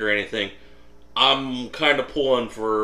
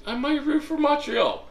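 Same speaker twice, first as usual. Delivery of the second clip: mocking high voice. Mocking the cousin's words.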